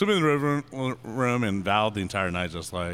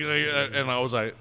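normal talking voice

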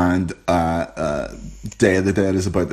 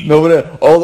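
ordinary talk, nothing marked

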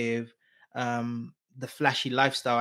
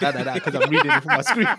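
fast, loud laughing protest; 3 semitones higher, 9 dB louder, faster than usual. Real, not acted. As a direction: laugh through the excuse. Laughing at his own French.

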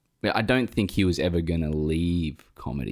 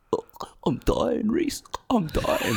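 high, whiny mock-dying whimper; mocking his sick friend's whining about dying; slower than usual. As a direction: ham up being on death's door, much higher. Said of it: "he was like".

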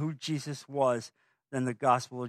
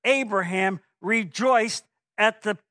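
forceful scripture reading; 6 semitones higher, 5 dB louder, slower than usual. Acted. Reading Jesus' words with force.

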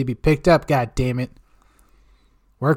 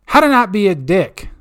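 louder, higher segment announcement; proud louder title call; hype title; 6 semitones higher, 7 dB louder than usual. Acted.

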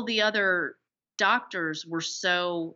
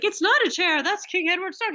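high-pitched, louder retold protest; mock indignation; 10 semitones higher, 4 dB louder than usual. King scolds his coach over King Edward's throne, mock outraged. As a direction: act out a scandalized correction. Retelling the king's outraged reply.

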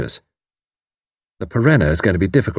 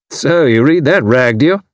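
loud, high hearty military man; retired commander teasing his neighbour, loud and hearty; bluff old commander; 5 semitones higher, 5 dB louder than usual. A narrator's reading. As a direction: boom it out like a blustering retired officer. Gruff commander demanding.

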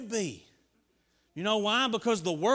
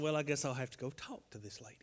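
whisper; 5 semitones lower, 9 dB quieter, faster than usual. Retold or imitated. Retelling his own aside.

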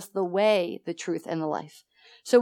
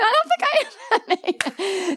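high, slow, tearful voice breaking; start crying; 10 semitones higher, much slower than usual. Retold or imitated. Answering Jean the third time, voice breaking into tears. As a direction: let the words crack as the tears come. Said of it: "And then I start crying".

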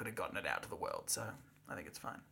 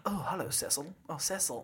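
Gatsby; 6 dB quieter than usual. Pretend. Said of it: "I'll be Gatsby".

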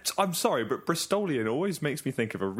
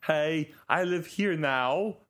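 slow, put-on Swedish accent for the caller; a mild mock Swedish voice; fake Swedish accent; 3 semitones higher, slower than usual. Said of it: "so that I don't sound like me".

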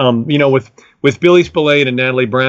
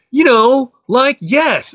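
a much higher friend voice naming the band; imitating his friend Dan; 12 semitones higher, much slower than usual. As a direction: prompt him like it's obvious. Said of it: "And he goes".

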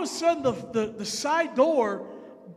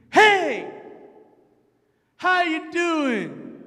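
loud, high, drawn-out bubbly greeting; 4 semitones higher, 7 dB louder, much slower than usual. Retold or imitated. Bubbly stranger greeting tired man in lobby, loud and drawn out. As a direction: imitate an over-friendly bubbly woman. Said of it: "was bubbly".

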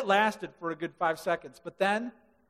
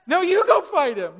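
scared pleading; 9 semitones higher, 7 dB louder than usual. Retold or imitated. Soldiers pushing each other forward.

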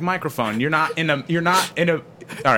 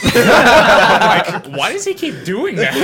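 laughing disbelief; 7 semitones higher, 7 dB louder, much slower than usual. Real, not acted. Laughing exasperated retelling.

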